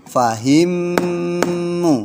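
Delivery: fahimmu with the mm held long, high and slow; held recitation. Shown as a demonstration. Teacher models reading for the class, held chant. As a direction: chant fahimmu holding the double m. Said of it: "Bacanya apa".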